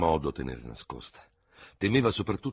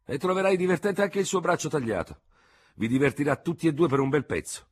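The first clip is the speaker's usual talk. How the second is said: high, louder biting retort; harsh, raised-pitch sarcasm; sudden harshness; 11 semitones higher, 5 dB louder than usual. A narrator's reading. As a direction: throw it back sharply. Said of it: "improvvisa asprezza di tono del tenente Dubert".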